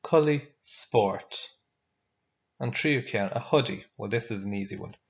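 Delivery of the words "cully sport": very slow, deliberate Irish phrase; very slow model phrase. Shown as a demonstration. Teacher modelling the Irish for tracksuit, very slowly. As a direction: stretch it out so the class can copy. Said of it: "You say it after me".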